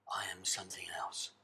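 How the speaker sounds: breathy whisper, almost voiceless. Shown as a demonstration. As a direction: whisper it like a secret. Whispered line, unvoiced.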